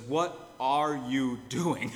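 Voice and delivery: slow, exasperated question. Retold or imitated. The minister with his face in his hands, slow and exasperated. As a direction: let the exasperation out slowly. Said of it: "he breathes a sigh of exasperation".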